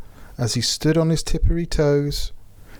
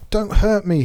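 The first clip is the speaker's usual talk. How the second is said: whimpered; 8 semitones higher than usual. Acted. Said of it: "he whimpered".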